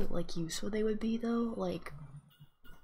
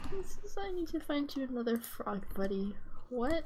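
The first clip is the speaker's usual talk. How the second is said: normal talking voice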